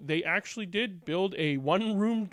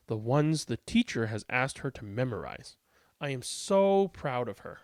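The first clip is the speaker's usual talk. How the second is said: deliberate, animated storybook reading, louder on key words; expressive read-aloud with lilting emphasis; picture-book narration; 8 dB louder, slower than usual. A narrator's reading. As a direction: read aloud to children, lively and measured.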